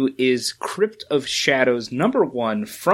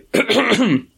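exaggerated mock throat-clear; staged throat-clearing cough; 9 semitones higher than usual. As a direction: clear the throat mid-impression. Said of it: "had to clear his throat".

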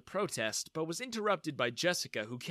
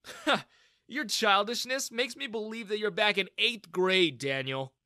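smirking; 6 semitones higher, 4 dB louder than usual. Acted. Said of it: "I smirked".

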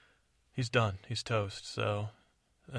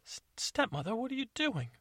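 high, sweet questioning voice; high cartoon princess; high Cinderella; 12 semitones higher than usual. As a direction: ask it in a high girly voice.

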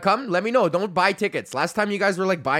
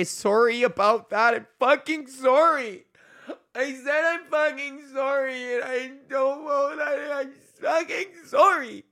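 much higher, slow, wailing mock-cry of sorry; hammed-up sobbing apology; 12 semitones higher than usual. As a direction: overplay a tearful breakdown for laughs. Mock anguished apology for lateness.

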